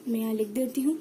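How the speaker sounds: normal talking voice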